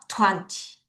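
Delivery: Twenty with strong TWEN, weak ty; TWEN-ty, light short ending; stress on the front. Shown as a demonstration. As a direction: hit TWEN, let ty drop short. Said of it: "T를 약하게 읽고".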